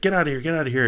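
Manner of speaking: half-acted bouncer barking them off; security guard chasing the poor kids away from the pizza shop, mock yelling.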